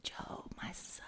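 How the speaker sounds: soft fatherly whisper; tender whisper. Acted. God whispering to Job as a son, tender. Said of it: "And God says".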